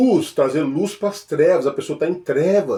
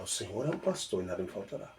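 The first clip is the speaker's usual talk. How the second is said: much softer, low and quick; murmured recitation; 6 semitones lower, 15 dB quieter, faster than usual. Shown as a demonstration. Believer repeating the psalm, quiet low mutter. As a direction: mutter the verse to yourself. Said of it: "Aí você fala".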